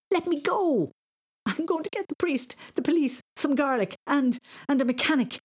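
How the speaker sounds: high, urgent panicked threat; very high frantic child; frightened fleeing boy. Acted. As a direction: cry out while breaking free.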